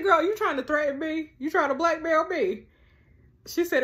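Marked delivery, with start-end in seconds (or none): 0.0-2.7 higher-pitched, affronted challenge; indignant confrontation. Acted. Confronting the childminder over her meds remark, high indignant challenge. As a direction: fire both questions up high, incredulous.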